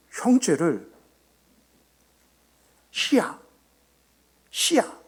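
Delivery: "희야, 씨야" called out very high and sing-song, as a Gyeongsang speaker says "형제"; 경상도식 "희야, 씨야"를 아주 높게 부르듯이; Gyeongsang pronunciation. Shown as a demonstration. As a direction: call "희야, 씨야" up high like a Gyeongsang speaker calling siblings. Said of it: "이렇게 불러요".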